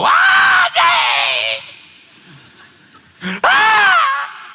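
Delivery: high shrieking fan scream. Retold or imitated. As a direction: shriek his name like a starstruck fan. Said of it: "I seen one girl go".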